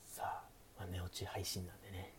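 hushed late-night chat, often whispered; sleepy. Pretend. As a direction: talk softly like the listener is drifting off. (小声でささやく寝落ち配信トーク)

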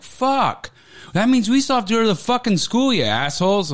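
high-pitched mock exasperation; hammed-up frustrated complaint; imitated fed-up teachers. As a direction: gripe it high, starting on a drawn curse. Voices fed-up teachers in retelling.

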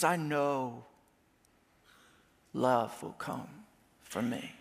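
softly sure, pausing between phrases; quiet confidence. Acted. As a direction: sink to a near whisper of certainty. Beloved speaks with quiet confidence.

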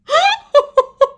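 high, loud burst of laughter; helpless shrieking laugh. Real, not acted. Cracking up at the clip.

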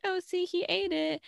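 high, put-on voice of a fibbing girl; imitating Noelle. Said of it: "and be like".

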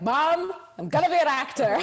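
put-on high, loud child announcing to mom; hammed-up youngster's proclamation; excited kid. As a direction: imitate a kid declaring a big dream. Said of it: "Sam growing up and be like".

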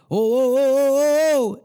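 high, loud rising panic oh's; mock panic as the mixer tips; panicked mixer guy. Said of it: "he's like".

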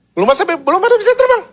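very high, rushed protest; mock alarm. Preacher acting out chicks falling before they can fly, mock alarm. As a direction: blurt it out fast in a high pitch.

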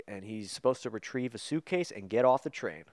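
ordinary talk, nothing marked

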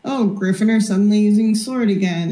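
ordinary talk, nothing marked

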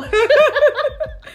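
high loud laugh; burst of laughter. Real, not acted. Laughs at friend's remark.

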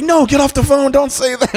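high frantic wife voice; shrill scolding up high; wife yelling. Retold or imitated. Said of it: "I could hear his wife like".

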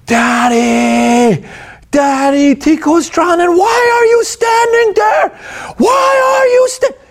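put-on high-pitched panicked child's scream; frantic shriek, imitating a small boy. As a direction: scream it drawn out like a terrified kid calling Dad. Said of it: "He is screaming. I've never in my life heard this kid scream".

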